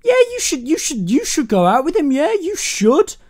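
high-pitched, over-eager mimicry; mock encouragement. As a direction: egg her on in a hammed-up high voice. Mocking Lee's encouragement to date.

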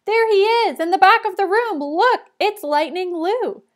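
put-on high, loud, thrilled kids shouting; excited shouts. A narrator's reading. Kids spotting their hero in the crowd, excited high shouting. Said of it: "Excited shouts went out".

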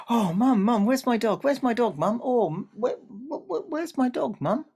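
put-on high, loud boy calling for his dog, stumbling; eager stammering child. A narrator's reading. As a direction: call out excitedly, tripping over words.

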